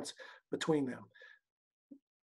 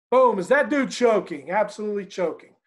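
excited; 4 semitones higher, 5 dB louder than usual. Real, not acted. Dramatic rhetorical question.

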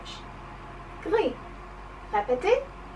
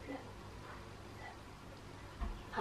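tiny whisper; hushed, barely audible repeat; really quiet; 23 dB quieter than usual. Shown as a demonstration. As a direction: whisper the French word. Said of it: "Really quietly".

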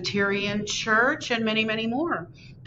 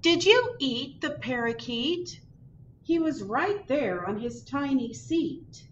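put-on girl asking cat; 7 semitones higher than usual. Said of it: "looks at her kitty cat and says".

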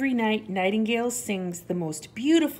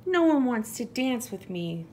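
mildly dejected little mouse; a high start sinking into mild disappointment; sighing; 6 semitones higher, 4 dB louder than usual. A narrator's reading. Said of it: "Little Mouse sighs".

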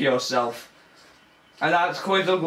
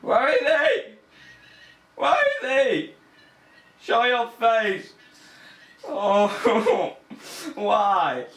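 higher, tense nervous calls into the dark; scared calling; 6 semitones higher, slower than usual. Real, not acted. Gamer calling out to the hidden monster, frightened and high.

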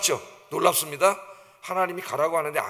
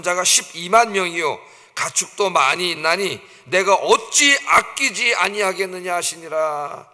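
loud, measured Bible reading; emphatic, deliberate recitation; scripture reading; 5 dB louder than usual. Acted. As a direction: declaim the verse to a congregation. Reads scripture aloud to congregation.

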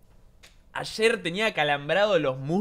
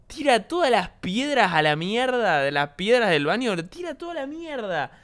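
exasperated rant; 6 semitones higher than usual. Real, not acted. Exasperated rant about the cat.

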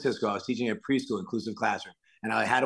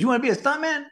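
put-on high-pitched, disbelieving kid question; imitated incredulous pupil; faster than usual. Pupil astonished at teacher's stuntman dream, high-pitched disbelief. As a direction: mimic a child's astonishment, voice shooting up.

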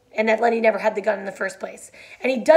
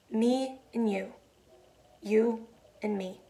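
tender; 7 dB quieter, slower than usual. A narrator's reading. George comforting before shot.

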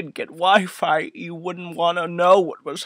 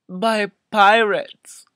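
ordinary delivery

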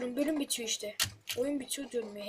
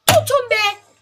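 loud frustrated yell at the game; 10 semitones higher, 13 dB louder, slower than usual. Real, not acted. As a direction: shout it out in exasperation. Frustrated gamer yelling at game.

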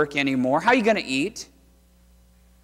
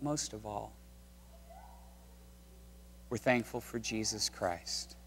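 hushed thanks; 5 semitones lower, 12 dB quieter than usual. Real, not acted. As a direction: draw them close, almost whispering. Preacher hushed, intimate thanks.

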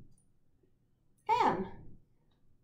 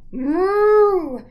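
loud cow moo; 8 semitones higher, 13 dB louder than usual. Acted. Cows mooing in story.

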